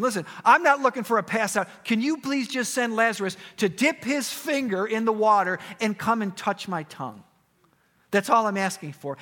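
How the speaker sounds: high, loud, urgent bargaining; acted pleading. Rich man asking Abraham for water, loud pleading. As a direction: beg for one small mercy.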